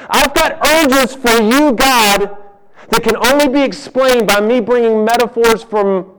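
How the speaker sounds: high, loud, fervent cry; impassioned cry. Acted. Preacher voicing David's longing for God, loud and fervent. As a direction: cry it out like a man starving for God. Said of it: "he says".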